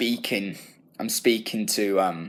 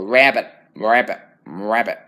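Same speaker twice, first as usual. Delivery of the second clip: "Rabbit" in an exaggerated American accent, first ones louder; 5 dB louder than usual. Shown as a demonstration. Student mimicking how Americans say rabbit, American accent. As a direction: croak "rabbit" American, like a frog. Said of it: "if you're saying it in an American accent".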